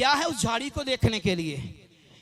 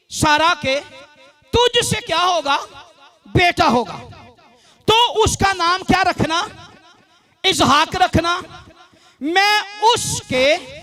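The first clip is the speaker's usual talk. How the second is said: loud, higher, drawn-out proclamation; preaching; 3 semitones higher, 6 dB louder, slower than usual. Real, not acted. Preacher quotes God's covenant to congregation, emphatic stretched preaching. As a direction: stretch key words and pause between phrases.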